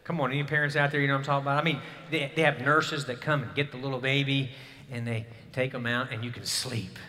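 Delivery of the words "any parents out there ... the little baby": normal talking voice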